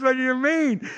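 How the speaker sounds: high-pitched, worked-up fan; retold excited question. Football fan asking the preacher back, excitedly.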